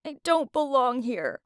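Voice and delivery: lightly tearful, voice lifting; understated crying plea to friends; a touch of tearful. Acted. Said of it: "I kept crying as I said".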